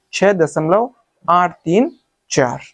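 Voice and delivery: slow, आठ, तीन, चार as separate digits; correct reading demo. Shown as a demonstration. Teacher showing students the right way, slow digit-by-digit reading. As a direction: space out आठ तीन चार slowly. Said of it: "इसको पढ़ने का सही तरीका ये है".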